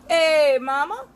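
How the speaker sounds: loud, high street catcall "Hey, mama!". Retold or imitated. A man on the street catcalls a passing woman, loud and high. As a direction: call out to her like a man on the corner.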